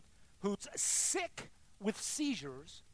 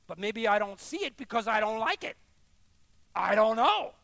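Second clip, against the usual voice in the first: raised, forceful voice building to a shout; exasperated outburst; 3 semitones higher, 8 dB louder than usual. Real, not acted. Preacher wrestling with a truth he dislikes, loud exasperation. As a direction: let the frustration burst out loudly.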